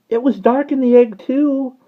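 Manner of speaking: very high, loud chick cheep. Acted. Fledgling cheeping about the egg, very high and loud. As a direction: pipe up like a chick. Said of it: "the fledgling cheeped".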